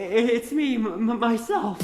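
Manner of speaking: halting, slowing confession; timid, stumbling follow-up to an outburst; nervous stammer. Acted. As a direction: stammer it out after losing nerve. Suitor falters after blurting.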